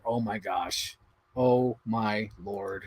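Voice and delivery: low, soft, drawn-out dismay; embarrassed aside. Retold or imitated. Daughter reacting to mom heckling the movie, low mortified aside. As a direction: groan it under your breath, slowly.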